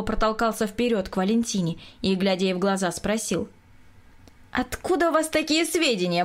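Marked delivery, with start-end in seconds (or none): 4.5-6.2 high, indignant question; indignant challenge. A narrator's reading. Bartov roughly confronts the young painter, indignant. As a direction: demand it, pitched up.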